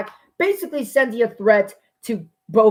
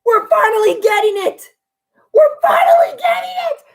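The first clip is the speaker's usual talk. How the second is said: much higher, rising into a scream; joyful shout; faster than usual. Real, not acted. Fan yelling that the dream feud is finally happening. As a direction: yell it in triumph.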